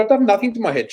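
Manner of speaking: high, protesting voice; a startled high-pitched protest; indignant denial. Retold or imitated.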